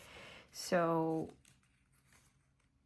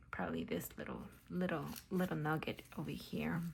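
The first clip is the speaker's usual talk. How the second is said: whispering; 3 semitones lower, 5 dB quieter than usual. Acted. Whispered craft chat.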